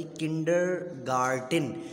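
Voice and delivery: "किंडरगार्टन" slowly, split as किंडर, गार्टन; "किंडरगार्टन" drawn out with a break in the middle; broken into parts. Shown as a demonstration. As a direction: sound out "किंडरगार्टन" in two slow parts. Teacher sounds out word in parts.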